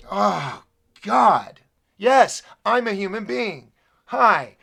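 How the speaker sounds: indignant, emphatic venting; exasperated outburst. Real, not acted. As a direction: burst out at the audience in frustration. Exasperated outburst at his viewers.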